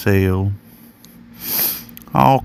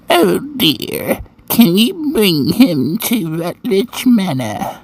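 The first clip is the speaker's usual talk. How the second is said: put-on high, slow old-lady voice; slow, high-pitched old dear; old woman's voice; 10 semitones higher, slower than usual. A narrator's reading. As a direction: play an elderly woman, drawn out and high. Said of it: "An old woman's voice answered".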